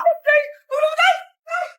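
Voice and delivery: high-pitched hammed-up wail; comic shrieking plea; panicked cry for help. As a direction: call desperately for dad. Child cries to father for help.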